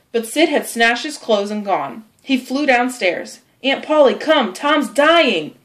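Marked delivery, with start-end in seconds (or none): 3.6-5.5 higher, urgent cry for help; alarmed calling. Acted. Sid calling his aunt to dying Tom, alarmed. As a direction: summon her in a panic.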